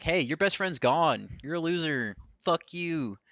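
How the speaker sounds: high-pitched, drawn-out mock trash talk; retold taunt. Wrestler taunting a rival whose best friend's gone, mock sneering.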